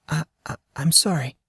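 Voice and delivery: a frightened whisper of an apology; fearful stammer. Acted. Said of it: "The fear was still prevalent in her voice".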